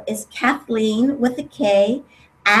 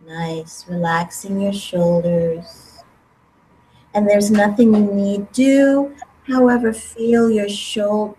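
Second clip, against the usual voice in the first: guided meditation; 3 semitones lower than usual. Acted. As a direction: calmly guide them, unhurried. Leads a guided breathing meditation.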